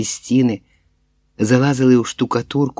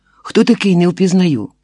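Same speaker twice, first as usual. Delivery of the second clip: high, raised questioning voice; shouting down; 7 semitones higher, 4 dB louder than usual. A narrator's reading. Woman demands who is in the well, shouting down. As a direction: call down sharply for a name.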